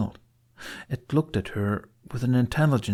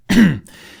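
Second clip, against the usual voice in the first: embarrassed little throat clearing; nervous throat clear before answering; awkward throat clear; 9 semitones higher, 13 dB louder than usual. Acted. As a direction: clear the throat to buy time. Awkward pause in conversation.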